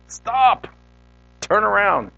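high, emphatic preached command; God commanding. Acted. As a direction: call out each order, separated. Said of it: "God is saying that to us too".

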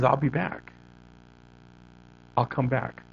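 quiet movie-robot impression, only lightly put on; Terminator quote. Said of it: "And just hear, he says".

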